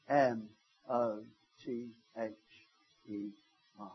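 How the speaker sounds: quiet, awed spelling of each letter; hushed spelling. Retold or imitated. Spelling out the word slowly.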